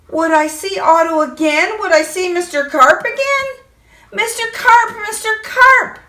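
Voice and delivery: high calling out in fear; frightened child calling. Acted. Boy calls into the pool, high scared cries. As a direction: call out scared for him. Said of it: "I yelled".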